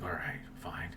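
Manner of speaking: whispered, grudging; whispered reply. Retold or imitated. Housemate drawing in the dark dismissing him, whispered. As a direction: breathe it out, curt and unbothered.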